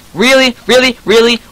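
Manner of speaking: high, rapid-fire incredulous questions; indignant high-pitched protest; exasperated disbelief. Real, not acted. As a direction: fire it out high, each one the same. Repeated disbelief at dying in game.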